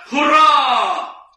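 loud, high, drawn-out hurrah; team's cheer. Acted. Winning team celebrates a goal, loud drawn-out cheer. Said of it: "joukkue huutaa".

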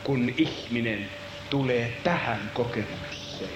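low, soft, solemn telling; hushed narration. Acted. Preacher telling the prophet's experience, hushed. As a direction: speak it low and grave.

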